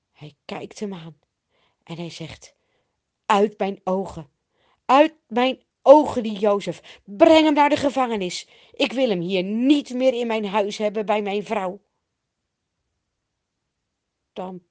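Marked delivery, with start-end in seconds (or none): 3.3-11.8 loud, forceful acted command; angry banishing. A narrator's reading. Potifar sends Jozef to prison, angrily commanding. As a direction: order him out of your sight.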